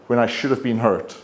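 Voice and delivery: normal talking voice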